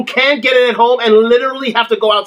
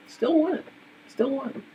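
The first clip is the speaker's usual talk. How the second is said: softer and softer, like an echo; fading echo; 12 dB quieter than usual. Acted. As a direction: let the words echo away into silence. Repeating the phrase, fading away.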